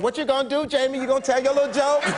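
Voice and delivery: high, taunting heckler; egging on. Retold or imitated. Woman in the front goading the comic, high taunt.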